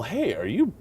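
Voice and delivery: high, soft put-on fan voice; imitated fan. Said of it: "when I get that occasional".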